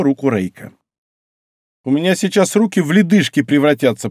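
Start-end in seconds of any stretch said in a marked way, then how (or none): none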